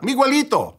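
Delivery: high, urgent call of a name; mom yelling out. Retold or imitated. Mom calls to the man at the door, yelled out high. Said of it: "my mom yelled out".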